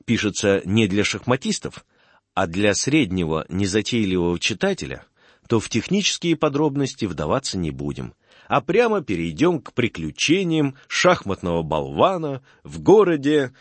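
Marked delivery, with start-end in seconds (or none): none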